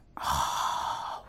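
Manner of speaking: long, soft exhale of dismay; heavy sigh. Acted. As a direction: let the breath out slowly, at a loss. Acted dismay at the thought.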